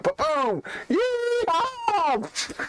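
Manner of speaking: high whooping yeehaw; excited yeehaw. Acted. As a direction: cheer it like a cowboy. Character lands, cheering.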